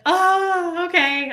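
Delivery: high, drawn-out swooning "oh"; melting. Retold or imitated. Woman recalls giving in to a flirty husband, swooning. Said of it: "I would just melt".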